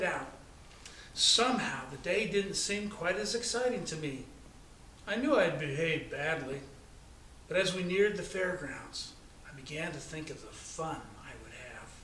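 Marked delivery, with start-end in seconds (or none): none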